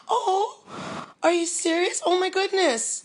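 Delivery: high, teary gasp of disbelief; can't believe the gift; overwhelmed surprise, voice catching high; stunned delight. Real, not acted. Told a book was made.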